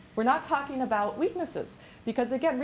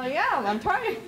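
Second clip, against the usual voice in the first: higher, louder, a laugh in it; laughing reply; 6 semitones higher, 3 dB louder, slower than usual. Retold or imitated. Replying to her husband, sheepishly laughing. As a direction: answer him with a sheepish laugh. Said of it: "I go".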